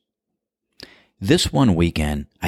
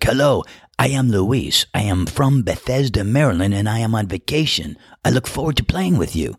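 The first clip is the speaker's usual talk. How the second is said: put-on higher, cheerful Spanish-accented broken English; 5 semitones higher than usual. Golfer introducing himself to new partners, Spanish accent. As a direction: imitate Luis's Spanish accent, eager and bright. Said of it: "Luis had a heavy Spanish-influenced broken English accent".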